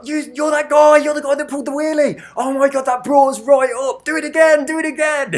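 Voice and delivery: high, loud imitation of thrilled lads; stupidly excited. Lads in the Mini recognising the wheelie rider, stupidly excited. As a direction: shout it like an overexcited fan, pitched right up. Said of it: "they were stupidly excited".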